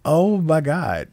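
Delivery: a mock-stunned boss; shocked supervisor. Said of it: "the supervisor was looking like".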